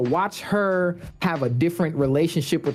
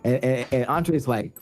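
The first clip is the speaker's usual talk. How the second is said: ordinary delivery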